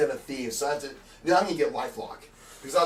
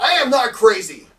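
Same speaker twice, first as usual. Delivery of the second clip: high, loud protest; indignant shout; 7 semitones higher, 9 dB louder than usual. Acted. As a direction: insist it loudly, voice raised. Protesting being called crazy.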